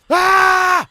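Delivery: very loud, high mock scream; sudden scream. Shown as a demonstration. Passenger screaming as someone sits down, loud scream. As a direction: scream out of nowhere. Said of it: "Just scream. Just go".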